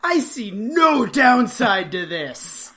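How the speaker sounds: loud, mock-naive enthusiasm; eagerly on board. Host as clueless kid joining a story, eager delight. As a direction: start high, settle into gleeful certainty. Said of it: "he's so on board".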